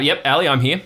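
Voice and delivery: dad coming briskly onto the line; lightly voiced dad. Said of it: "the phone changes hands. It's like".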